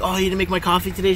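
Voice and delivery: ordinary talk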